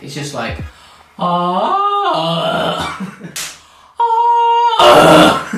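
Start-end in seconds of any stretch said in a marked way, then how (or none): 1.2-5.5 voice crack. Shown as a demonstration. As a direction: voice cracking up into a squeak. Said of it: "we just have the biggest voice crack".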